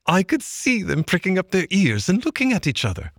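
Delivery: high-pitched retelling voice; Mole's excited tale. Acted. Mole recounting the stoat sergeant, pitched high. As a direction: relish every word of the trick. Mole tells his adventure.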